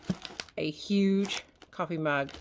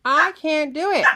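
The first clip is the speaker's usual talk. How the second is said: frustrated louder; 9 semitones higher, 8 dB louder than usual. Real, not acted. Struggling with party bazooka.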